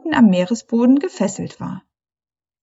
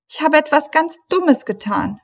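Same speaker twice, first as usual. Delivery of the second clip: schluchzend; 7 semitones higher than usual. A narrator's reading. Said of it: "schluchzte die Meerjungfrau".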